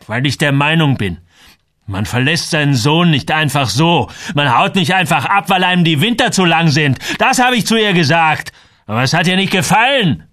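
loud, high, climbing outburst; agitated tirade. A narrator's reading. Samuel justifies himself to Joel, worked up and furious. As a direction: let the anger build to a near shout. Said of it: "Samuel war so erregt, dass er zitterte".

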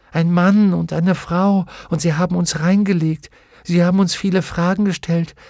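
ashamed little creature blurting it out; anxious daemon. A narrator's reading. Narrator voices daemon Pantaleimon.

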